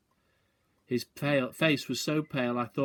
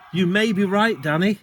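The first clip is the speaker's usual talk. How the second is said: excited; 7 semitones higher, 9 dB louder than usual. A narrator's reading. Father excited in dark.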